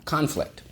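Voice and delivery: "Conflict" as CON-flict, first syllable high; stress on first syllable. Shown as a demonstration. Teacher models the whole word for pupils, first-syllable stress. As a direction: punch the first half of "Conflict" and let it fall. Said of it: "the accent is on the first syllable".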